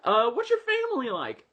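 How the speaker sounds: high-pitched, breakneck excited gushing; hammed-up hyperactive squeal, easing down into an eager question; Webby, hyper. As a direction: imitate an overexcited cartoon girl. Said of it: "hypergenic. She's like".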